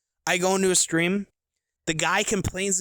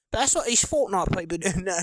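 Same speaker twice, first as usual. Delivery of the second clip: put-on goofy stab at an Australian accent; 4 semitones higher than usual. Streamer drifting into accent while mocking Fortnite players, playful Australian accent. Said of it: "I don't even want to switch to Australian".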